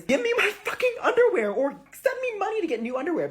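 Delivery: high, laughing demand; half-joking furious plea; laughing outrage. Real, not acted. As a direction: rant at British Airways, laughing. Ranting at the airline.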